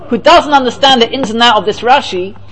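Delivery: normal talking voice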